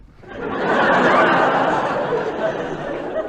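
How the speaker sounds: high, loud, strained nervous laughter. Acted. Tony laughing in the convict's face, nervous high laugh. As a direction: laugh it off in a panic, too high.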